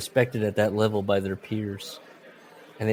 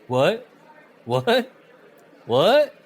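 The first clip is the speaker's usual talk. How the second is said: baffled asking; 9 semitones higher, 7 dB louder, much slower than usual. Retold or imitated. Repeatedly asking guys.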